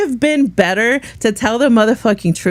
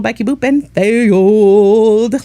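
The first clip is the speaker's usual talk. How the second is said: drawn-out mock; 4 dB louder, much slower than usual. Mock Becky Boop.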